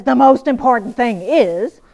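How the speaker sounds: slow, loud "most important"; mock emphatic writer; quoted signal phrase. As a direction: announce it like a writer flagging. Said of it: "If a writer says".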